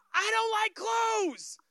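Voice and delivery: put-on high, drawn-out kid's holler; imitated child's yell. Small son running round the house refusing clothes, imitated child's yell. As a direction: bellow it like a defiant little kid, stretching the words. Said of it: "he's saying".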